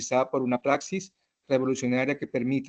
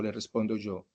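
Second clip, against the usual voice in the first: ordinary delivery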